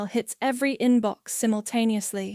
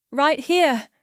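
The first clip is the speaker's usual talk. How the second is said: raised, firm, steady answer; 5 semitones higher, 5 dB louder than usual. A narrator's reading. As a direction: answer loudly without wavering. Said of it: "keeping my voice steady".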